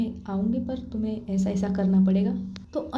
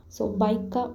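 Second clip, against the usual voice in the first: ordinary delivery, nothing marked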